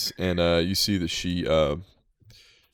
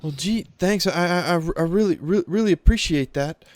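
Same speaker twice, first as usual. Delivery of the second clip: put-on high, stammering kid's voice; nervous boy; 10 semitones higher, 4 dB louder than usual. Boy thanking the player for the gold, high stammering kid. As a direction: stumble over every word like a shy boy.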